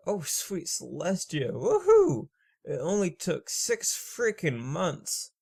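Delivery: a whoop leaping high then slow relief; overjoyed cheer. Acted. Mare celebrating the long-awaited yes, a high whoop. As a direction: cheer like the wait was endless.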